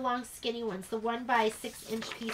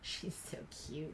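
whispering; 8 semitones lower, 14 dB quieter than usual. Real, not acted. Whispers about the dog.

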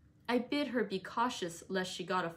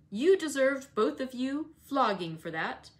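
put-on louder, harsh scolding of the girl; stern rebuke; 5 semitones higher, 6 dB louder than usual. Heathcliff scolding Catherine about the letters, louder and stern. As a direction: threaten her with a flogging.

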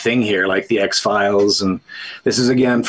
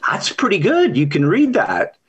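higher-pitched, acted approval; impressed optician; 6 semitones higher than usual. Optician praising his patient in a joke, impressed. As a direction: sound pleasantly surprised, pitched up.

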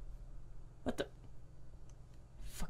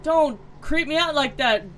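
normal talking voice

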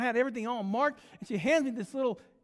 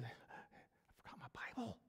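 hushed, urgent confession; nervous whisper; 18 dB quieter, much faster than usual. Acted. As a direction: whisper it to the man beside you. Nervous preacher leans over to confide.

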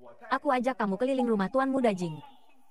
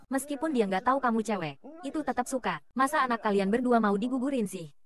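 normal talking voice

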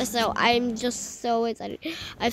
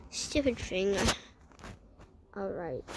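ordinary delivery

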